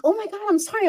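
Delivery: high-pitched imitation of a startled man; flustered apology. Caught man apologizing to passing women, acted flustered. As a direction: blurt a mock-panicked apology.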